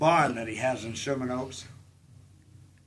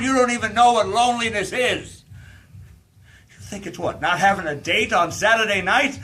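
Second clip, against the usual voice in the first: higher and louder, sneering at her; scornful outburst; 7 semitones higher, 7 dB louder than usual. Acted. Scorning the girl's idea of loneliness in a loud sneer. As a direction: throw her innocence back at her. Drunk woman scorning the girl.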